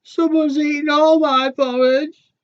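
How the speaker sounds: very high and loud squeak; little cub wailing about the porridge; baby bear crying out to mum and dad. A narrator's reading. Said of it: "little baby bear says".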